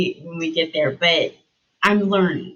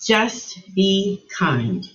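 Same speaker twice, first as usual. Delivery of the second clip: slow quoted words; much slower than usual. Retold or imitated. Repeating the heard words.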